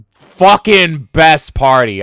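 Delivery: slow, loud, emphatic; hyped drawn-out praise; excited. Real, not acted. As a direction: savor the idea. Enthusing about Ballmer party.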